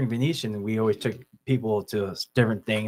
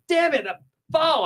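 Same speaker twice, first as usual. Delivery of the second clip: mock outraged call. Retold foul call, laughing.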